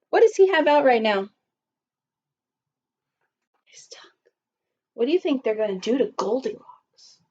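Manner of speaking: ordinary delivery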